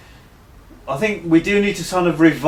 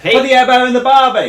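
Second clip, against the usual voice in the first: hammed-up Australian accent, higher and louder; 10 semitones higher, 7 dB louder than usual. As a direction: belt it out like a cartoon Australian bloke. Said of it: "it's Australian".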